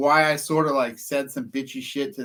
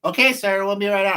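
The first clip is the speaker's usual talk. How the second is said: higher, louder singsong dispatcher voice; mock dispatcher; 7 semitones higher, 6 dB louder than usual. Caller mocks dispatcher's reply, higher and louder. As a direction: chirp it like a cheery operator. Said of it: "And the lady's like".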